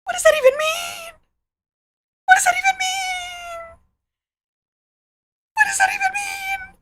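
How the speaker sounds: squealed high, disbelieving repeat; high-pitched outraged squeal; shrieking disbelief. Real, not acted. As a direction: shriek it again and again, horrified.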